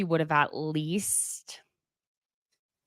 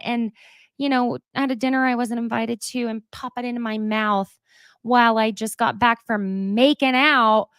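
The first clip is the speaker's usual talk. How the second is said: mocking Tom Schwartz; 5 semitones higher than usual. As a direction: play innocent and sweet, mocking him. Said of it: "masquerading as".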